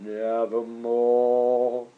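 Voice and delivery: low, slow, eerie croak; raven's reply. Acted. Raven answering the poet with a low, slow croak. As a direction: draw it out like a dark raven. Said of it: "Quoth the raven".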